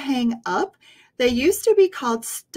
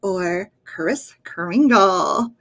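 put-on louder, clear clue reading for kids; riddle reading voice; 6 dB louder than usual. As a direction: read each clue big and clear. Reads riddle clues.

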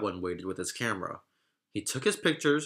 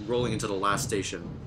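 ordinary delivery, nothing marked

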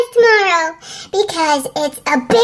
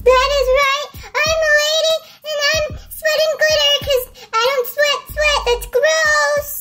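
higher ladylike voice; 8 semitones higher than usual. Shown as a demonstration. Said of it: "talk a little bit higher".

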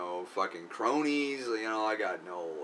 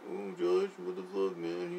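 higher, softer girlish mock voice; imitating her; 8 semitones higher, 5 dB quieter, slower than usual. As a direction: mimic the girl whining at Josh, lightly. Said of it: "And then she's like".

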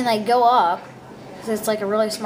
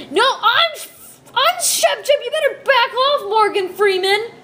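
high squeaky tough guy; 11 semitones higher, 4 dB louder than usual. Pretend. Kid threatens in a put-on voice.

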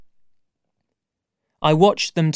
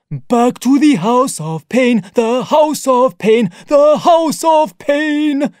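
high, loud, slow chant; singsong ape man; 10 semitones higher, 6 dB louder, slower than usual. Acted. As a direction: sing it out gleefully, building to a shout. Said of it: "sang the ape man".

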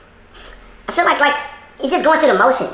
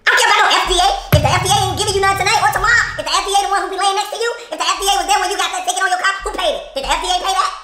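high-pitched rapid-fire challenge; indignant tirade; 7 semitones higher, faster than usual. Acted. Wife berates husband over the F D A, indignant. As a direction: pile on accusing questions. Acted quarrel, she berates him.

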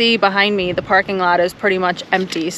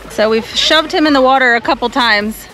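ordinary delivery

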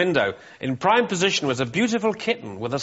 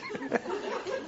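hysterical laugh; 11 dB quieter than usual. Real, not acted. Reader helpless with laughter.

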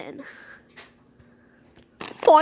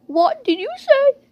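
sharp, suspicious question, higher and louder; suspicious demand; 9 semitones higher, 5 dB louder than usual. Pretend. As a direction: catch the pet plotting, demand it. Owner hears the plot again.

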